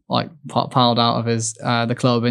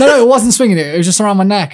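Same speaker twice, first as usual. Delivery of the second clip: much higher, louder denial; excited protest; 8 semitones higher, 5 dB louder, faster than usual. Real, not acted. Athlete correcting the host's medal story, high and loud. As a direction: insist eagerly it wasn't so.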